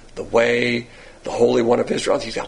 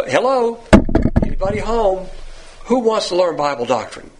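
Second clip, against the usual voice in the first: mock calling out; 6 semitones higher than usual. Mock calling out to empty house.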